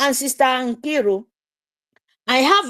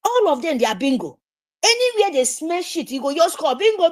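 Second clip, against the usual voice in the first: normal talking voice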